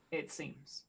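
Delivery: usual speaking voice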